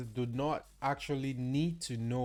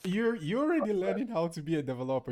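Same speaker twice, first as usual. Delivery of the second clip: excited teasing; 7 semitones higher, 3 dB louder, faster than usual. Real, not acted. Teasing praise.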